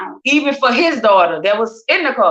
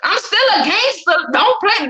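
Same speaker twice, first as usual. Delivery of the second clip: high, cocky mock tough talk; a teasing put-on gangster brag; 10 semitones higher than usual. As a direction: play the gangster, half joking. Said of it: "Nah, I'm just playing".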